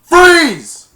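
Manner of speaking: very loud, high command; sudden cop yell; police shout. Retold or imitated. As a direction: freeze them on the spot. Police burst in.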